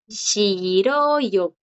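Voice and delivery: Shiroyo stretched out syllable by syllable; drawn-out Shi-ro-yo, rising on ro and falling away; silent J. Shown as a demonstration. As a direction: model Shiroyo slowly, no h before the vowel. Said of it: "Igual la J no suena".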